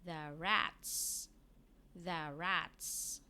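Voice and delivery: "the rats" said clearly and slowly; teacher model of "the rats"; model reading. Shown as a demonstration. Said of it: "we have here".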